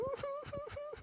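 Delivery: high, soft mock sobbing; imitated crying. Father imitating his child's sobs during study, mock crying. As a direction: mimic a child's wailing "hu hu hu".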